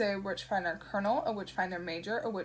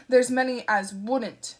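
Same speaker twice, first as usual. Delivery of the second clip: booming, slow and much louder; full volume; 3 semitones higher, 9 dB louder, slower than usual. Acted. Shadwell warns Newton on the phone, booming. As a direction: crash back in at full blast. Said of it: "then crashed back to full volume".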